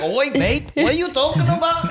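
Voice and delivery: hammed-up Aussie bloke; bad Australian accent. Mocking a guy from Austin in a bad Australian accent. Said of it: "I kept giving him an Australian accent".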